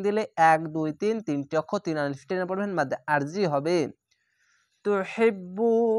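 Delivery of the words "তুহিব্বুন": তুহিব্বুন with the final un stretched long; Quranic recitation. Shown as a demonstration. Teacher recites the word slowly for students.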